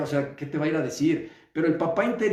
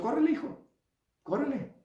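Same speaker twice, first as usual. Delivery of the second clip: high, bright-pitched urging, a touch softer; eager encouragement; 9 semitones higher, 4 dB quieter than usual. Retold or imitated. Loving father urging his son to flee, eager. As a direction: cheer him on to run.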